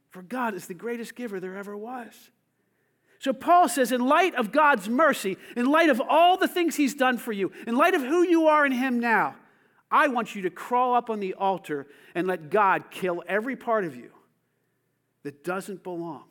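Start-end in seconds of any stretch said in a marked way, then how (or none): 3.2-13.8 1 preaching fervor. Real, not acted. Preaching at full force.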